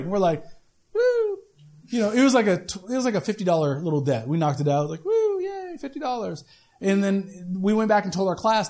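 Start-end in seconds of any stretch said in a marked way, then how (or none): 0.9-1.4 high, half-hearted woo; a deadpan little cheer; sarcastic woo at a tiny win; mock cheer. Mock celebration of small debt. 5.0-6.4 high woo sinking into a soft, dry "fifty dollars"; sarcastic cheer over a tiny payoff; mock cheer.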